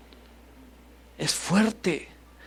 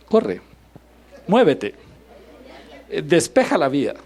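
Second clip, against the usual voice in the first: louder mock driver yelling; mock road rage; 2 semitones higher, 5 dB louder than usual. Driver yelling at another in traffic, mock angry. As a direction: bark at the car ahead, hammed-up.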